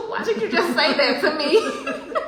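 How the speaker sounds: loud, high, slow cry through laughter; retold astonishment. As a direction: drag it out high, laughing in disbelief. Retelling her stunned reaction to stranger.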